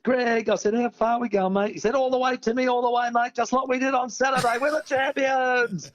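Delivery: high, hyped-up retold mate cheering him on; excited mate. Mate on the ladder urging Timmy upward, hyped and high. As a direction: pump him up like a footy teammate.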